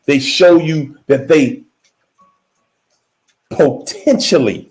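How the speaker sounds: low, slow delivery broken by long pauses. Shown as a demonstration. Speaker restating what narcissists seem to offer, slow and low. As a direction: let each hedge stand alone, slowly and low. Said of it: "I said that slow like that".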